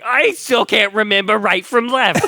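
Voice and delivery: high, laughing dim scientist; goofy scientist voice. Retold or imitated. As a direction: act like a clueless genius. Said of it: "but he's like".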